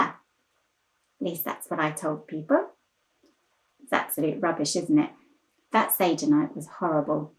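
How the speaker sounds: low, subdued admission of the truth; quiet confession. Acted. Confesses the cheerful account was false.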